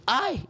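sudden high "ay" of chest pain; cry of pain. Retold or imitated. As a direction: yelp as if squeezed inside. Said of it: "yo dije así".

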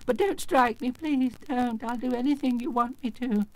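high, frightened little-man voice; trembling plea. Acted. Little man pleading with the axe-wielder, trembling. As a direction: beg to be spared, pitched high. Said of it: "in a trembling voice".

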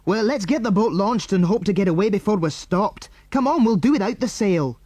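high, pressing instructions; urgent. A narrator's reading. Andy urges a quick escape.

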